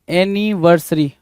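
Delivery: "Anniversary" drawn out slowly, part by part; slow careful pronunciation. Shown as a demonstration. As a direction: stretch "anniversary" slowly for the class. Teacher spells out word slowly.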